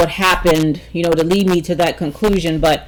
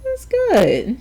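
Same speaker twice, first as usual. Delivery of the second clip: much higher, softer mom voice; a high, soft mock mom caught off guard; surprised mom; 5 dB quieter, much slower than usual. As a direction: squeak it out like a startled mother. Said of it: "She was like".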